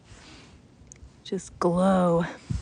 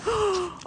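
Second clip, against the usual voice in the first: sharp high gasp of delight; a real gasp of joy; excited gasp; 13 semitones higher, 3 dB louder than usual. Real, not acted. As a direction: gasp at the sight of it. Spots her favorite fish.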